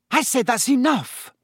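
exasperated second warning; stern repeat. A narrator's reading. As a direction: shut the twins down for good.

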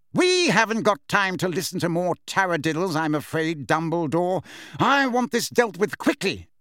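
pompous impatience, rising in pitch; Fudge, impatient. Acted. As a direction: brush off the objection and hurry things along.

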